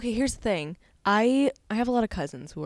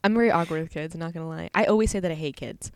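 ordinary delivery

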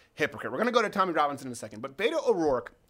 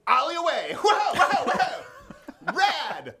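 laughing mock skater; 10 semitones higher, 4 dB louder, slower than usual. Mocking a skater's attitude.

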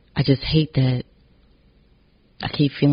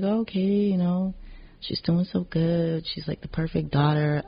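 imitating family; 7 semitones higher, 5 dB quieter than usual. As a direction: lightly voice the proud family. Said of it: "they be like".

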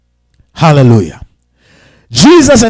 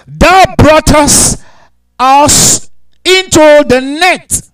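preached scripture; 9 semitones higher, 6 dB louder, much slower than usual. Acted.

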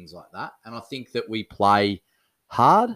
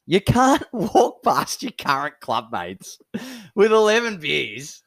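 indignant scolding through laughter; drawn-out, laughing mock outrage; 7 semitones higher than usual.

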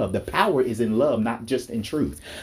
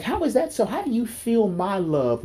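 amazed wonder; 10 semitones higher than usual. Retold or imitated. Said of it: "And I'm like".

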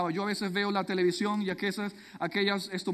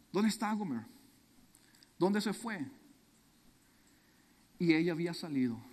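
ordinary talk

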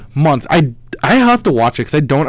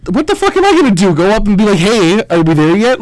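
exasperated retelling; 7 semitones higher, 4 dB louder than usual. Retold or imitated. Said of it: "I'm like".

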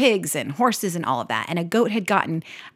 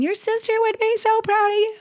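much higher, excited praise to her son; delighted praise. Real, not acted. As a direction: gush with pride over him. Mother praising son while videoing.

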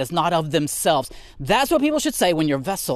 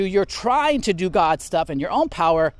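usual speaking voice

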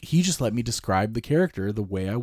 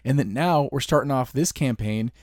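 ordinary talk, nothing marked

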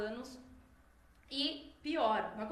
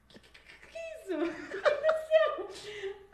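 shocked stammer; 9 semitones higher, 8 dB louder, slower than usual. Retold or imitated. Father shocked at the hairdo.

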